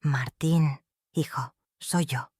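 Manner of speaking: soft, slow and breaking, a mother near tears; voz que se quiebra, suave y lenta. Acted. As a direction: let the voice crack and fade on hijo. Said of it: "dejé que mi voz se quebrara".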